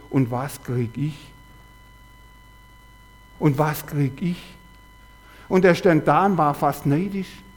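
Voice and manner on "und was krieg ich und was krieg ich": envious inner voice. Retold or imitated. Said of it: "eine Stimme kommt in ihm hoch".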